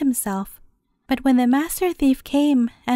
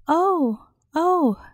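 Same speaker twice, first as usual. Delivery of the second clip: higher, frightened moans from inside a sack; crying out in fear; 4 semitones higher than usual. A narrator's reading. Priest being dragged over stones, frightened cries. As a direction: cry out as the scared priest. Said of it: "cried the priest in the sack".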